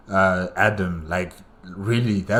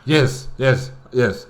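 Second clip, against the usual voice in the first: loud, higher cheer of being right; triumphant yes; 5 semitones higher, 4 dB louder, slower than usual. Real, not acted. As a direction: celebrate getting it right. Guess confirmed by the game.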